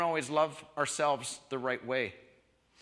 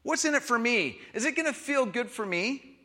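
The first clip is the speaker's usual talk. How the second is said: selfish voice; 6 semitones higher than usual. Retold or imitated. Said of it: "a love that doesn't ask".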